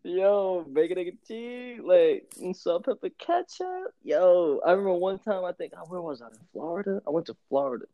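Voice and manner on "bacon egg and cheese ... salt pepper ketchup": savoring. Pretend. Describing breakfast sandwich lovingly.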